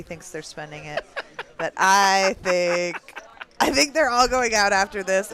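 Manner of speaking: host the final table like live TV; mock live poker commentary to viewers; announcer voice. Mock tournament broadcast.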